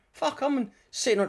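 comedian's hammed-up realisation; mock amazed thought. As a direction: act out your own wide-eyed disbelief. Retelling his own amazed thought.

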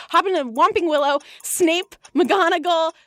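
a light parody of a friend's rushed recap; impression of Casper. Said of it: "That was my impression of you".

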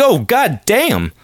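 imitating a street catcall at her smile; mock catcall. Reacting to her smile, mock catcall voice. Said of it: "I was like".

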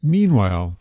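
plain reading voice

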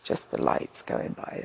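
hushed whisper, lost in the moment; whispering as the ceiling lights pass. Retold or imitated. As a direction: breathe it out like a dreamy aside. Lying on gurney watching lights pass.

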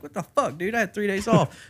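mock-angry protest; pissed. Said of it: "So I was pissed".

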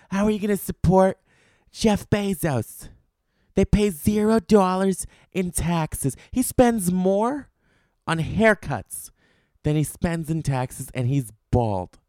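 high, slow whiny scold mocking online critics. Comic imitating his nagging listeners, high slow mock scold. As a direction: lecture him like a smug Amazon hater. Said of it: "I know everybody's gonna be up my ass".

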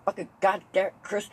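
high, loud flustered cursing; short-circuiting dad. Retold or imitated. Dad fumbling his kid's name, flustered anger. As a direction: imitate a dad losing his temper. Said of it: "I got my dad short-circuiting once".

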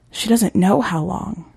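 usual speaking voice

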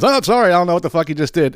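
lightly mock the checkout machine; sing-song fake-polite machine. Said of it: "It says".

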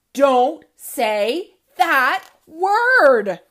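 loud, very slow refrain; comic refrain shout. A narrator's reading. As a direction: drag out the refrain loudly.

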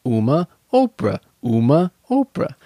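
dry talk-show host delivery; deadpan Letterman-style intro; Letterman impression. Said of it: "do my Letterman".

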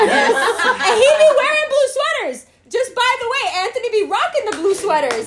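high, loud, laughing tease. Real, not acted. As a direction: giggle while teasing. Teasing about blue sweaters.

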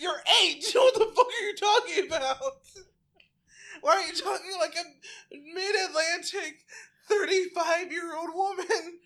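cracking up while mock-scolding; laughing disbelief. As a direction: splutter through laughter at the kid. Mocking reply, laughing.

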